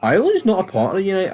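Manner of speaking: mock pedantic correction; mock objector. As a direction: rattle it off like a pedantic commenter. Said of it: "Before anyone goes".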